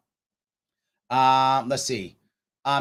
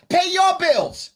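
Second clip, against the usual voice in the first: higher, louder, slow mock shout; mocking repeat; 10 semitones higher, 5 dB louder, much slower than usual. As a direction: pay your bills shouted high and slow, drawn out. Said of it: "I got guys that are saying".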